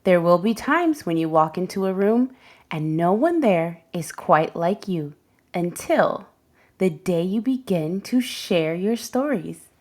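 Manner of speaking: plain reading voice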